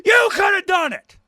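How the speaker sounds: high, loud retort that builds to a yell; exasperated shout. Retold or imitated. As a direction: yell it back at the lazy emailers. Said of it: "And I go".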